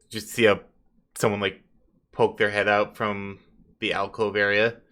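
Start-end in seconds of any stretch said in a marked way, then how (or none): none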